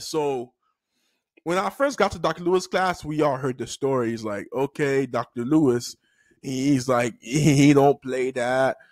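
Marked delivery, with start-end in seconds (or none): none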